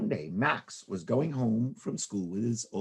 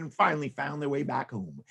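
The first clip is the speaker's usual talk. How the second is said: ordinary delivery, nothing marked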